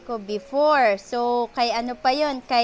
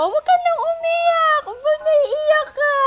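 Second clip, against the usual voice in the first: very high, loud cooing comfort; babying the dog with a squeaky soothing voice; soothing baby talk; 6 dB louder than usual. Pretend. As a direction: comfort the pup as if it were crying. Pretending dog is crying.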